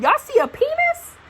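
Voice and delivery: indignant surprise at the nurse; high outraged question; shocked disbelief. Retold or imitated. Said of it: "I'm like".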